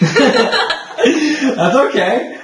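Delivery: high, laughing through the words; helpless giggles while reassuring; giggling reassurance. Real, not acted. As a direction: say it cracking up. Both break into giggles.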